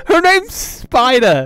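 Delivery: laughing. Real, not acted. Cracking up at the name.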